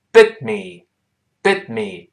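"bit me" run together with the t held silent; linked bit me. Shown as a demonstration. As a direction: hold the t in "bit" and move straight into "me". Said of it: "好，连读变成bit me".

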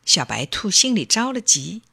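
plain reading voice

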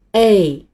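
"A" stretched long as English ay; 拉长声音读A; drawn-out A. Shown as a demonstration. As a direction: hold the ay sound of "A" out long. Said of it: "要把声音拉长".

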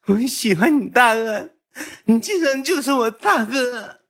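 very high mock crying voice; hammed-up squeal of a teary, devoted junior; imitated tearful plea. Tearful junior promising big brother to work harder, mock sobbing. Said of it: "我就一顿哄".

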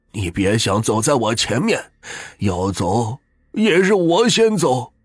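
husband refusing to outlive his wife; crying out, slowing at the end. A narrator's reading. Weeping husband answers wife.

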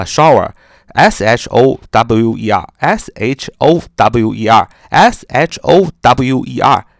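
slow spelled S H O W E R; dictating. Shown as a demonstration. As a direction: spell each letter slowly. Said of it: "淋浴叫shower".

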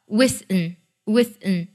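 "with an" as wis-n, weakened ending; nasal release. Shown as a demonstration. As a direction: say "with an" like wasting squeezed to wis-n. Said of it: "听觉上是这样的". (把“with an”读成鼻音爆破)

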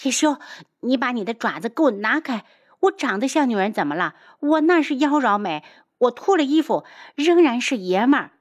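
high-pitched flustered tirade; indignant scolding. Acted. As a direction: protest hotly with wounded pride. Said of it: "只好继续骂".

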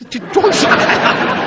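laughing. Real, not acted. Co-host cracking up at the dumb-act punchline, laughing loudly. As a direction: burst out laughing at the joke.

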